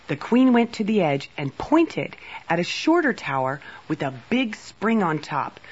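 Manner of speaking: ordinary delivery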